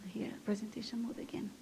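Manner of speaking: whispered to herself, off mic; a quick private whisper while fixing the slides; whispered aside. Real, not acted. Presenter whispers to herself while setting up slides, hushed aside.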